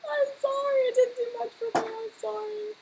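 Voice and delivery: high, hammed-up whimpering sorry; fake-sob your apology; mock crying apology. Apologising to a viewer who loves that primer, mock sobbing.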